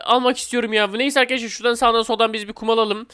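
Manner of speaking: usual speaking voice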